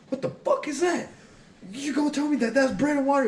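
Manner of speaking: retold outraged interrogation; pissed-off drill sergeant. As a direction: imitate a sergeant in furious disbelief. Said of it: "he got so pissed".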